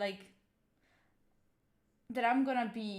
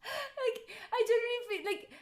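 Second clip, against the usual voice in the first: laughing; 13 semitones higher than usual. Real, not acted. Laughs while confessing.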